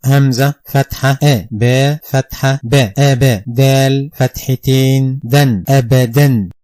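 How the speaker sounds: names each Arabic sign, then says its sound; Qaida spelling drill. Shown as a demonstration. Teacher drilling pupils through the full Qaida spelling. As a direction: chant "hamza, fatha, a" in the Qaida drill rhythm. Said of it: "is as the following".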